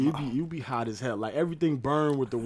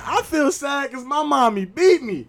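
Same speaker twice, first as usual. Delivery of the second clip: put-on even higher, louder child confession; little girl again; 11 semitones higher, 8 dB louder, slower than usual. Neffy repeats it to the whole class, higher and louder. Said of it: "She said it again".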